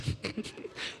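breathy, voiceless laugh squeezed out; stifled laugh. Real, not acted. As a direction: let a held-in laugh escape. Laughing at the story.

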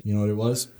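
ordinary delivery

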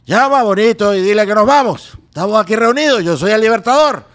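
loud, high commanding Bolívar voice; Luis Jerónimo. Acted. Impressionist dubbing the actor as Bolívar in a video, booming imitation. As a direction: proclaim it like the Liberator rallying his men. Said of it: "ya tenía la voz de Luis Jerónimo".

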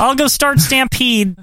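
high, drawn-out impression of a wrestler; slow right down in a high put-on voice; Bruce Hart. Co-host mimics a wrestler's brother, slow high mock voice. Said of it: "That's Bruce".

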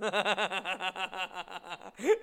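loud, high burst of laughter; laughing. Real, not acted. Comic imagines the man hung up on, loud cackling. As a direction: cackle helplessly at your own story. Cracks up at his own story.

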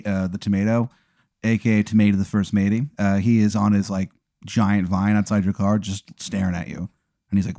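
normal talking voice

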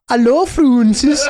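slow, drawn-out mock Scandinavian accent; goofy Scandinavian accent. Comic mocking how Scandinavians introduce themselves, goofy Scandinavian accent. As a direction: say it in a silly, stretched Scandinavian lilt. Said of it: "goofy accent".

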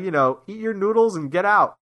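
ordinary talk, nothing marked

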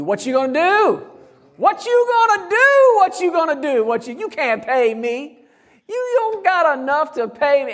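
high, mocking taunt of a bill. Preacher voicing a bill on the desk, taunting high. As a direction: sneer at them like a bill they can't pay.

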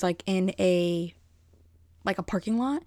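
ordinary talk, nothing marked

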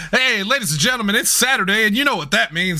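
high, quick show opening; show announcer. Acted. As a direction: hype the crowd like a show host. Host opens show with catchphrase.

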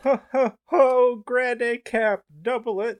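high loud hammy Santa; Santa Claus. Pretend. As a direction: boom it out as Santa. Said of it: "I am playing the roles of Santa Claus".

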